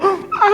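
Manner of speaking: a high, crying gasp; mock sob gasp. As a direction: catch your breath like about to weep. Parent pleading for child.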